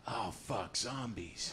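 a quietly voiced flash of fear; hushed dread. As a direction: mutter it like a scared thought. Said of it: "my first real thought was".

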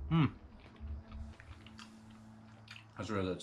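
talking while chewing food; muffled behind hand; mouth full, chewing. Real, not acted. Seen 3: Took chicken just before; closed mouth chewing, hand covers mouth.

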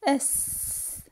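soft voiceless hiss on "S"; isolated s sound. Shown as a demonstration. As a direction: hold "S" as a bare sss. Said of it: "I pronounce the S".

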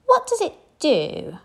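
put-on high curious child; a small girl asking eagerly; bright little-girl question; little princess voice. A narrator's reading. Princess asks about snow.

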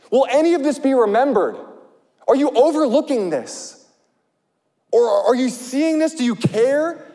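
high, pleading cry to God. Retold or imitated. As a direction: plead desperately, pitch way up. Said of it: "he's crying out".

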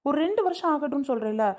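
high-pitched, indignant insistence; fed-up daughter's high protest; exasperated protest. Acted. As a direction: argue back at mother, pitch jumping up. Daughter protesting pressure to marry.

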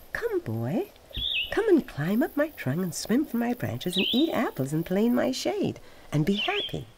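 high, eager call of a loving tree; tree's voice. A narrator's reading. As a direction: lift the voice high and coax the boy. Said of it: "and the tree said".

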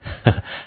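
brief, breathy little laugh; quiet chuckle before speaking; short soft chuckle. A narrator's reading. Huo Yuhao smiles at his teammates, softly chuckling. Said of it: "霍雨浩微笑道".

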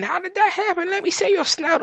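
high-pitched amazement; amazed. Real, not acted. Player amazed the pig changed colour, high-pitched surprise. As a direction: squeak it out in wonder.

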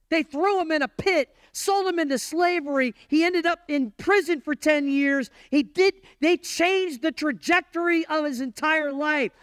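high, driving preacher cry; full-force preaching. Acted. Pastor stirring the congregation over Joseph's injustice, preaching hard. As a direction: lift the room with rising outrage.

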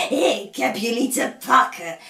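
loud gloating witch voice. Acted. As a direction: gloat over the trapped children. Witch gloats over captured children.

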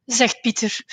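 ordinary delivery, nothing marked

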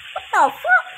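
very high shrieked what the fuck; shocked shriek. Real, not acted. Sudden shock in game.

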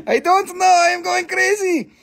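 very high comic wail; mock hysteria. Seller joking about going crazy with accents, high mock hysteria. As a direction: squeal it in mock meltdown.

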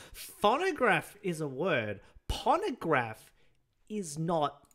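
phonograph and pornograph stretched out loudly; emphatic contrast. Shown as a demonstration. Explaining which one is a word, emphatic. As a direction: hammer both words slowly to contrast them.

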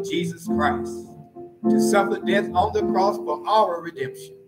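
usual speaking voice

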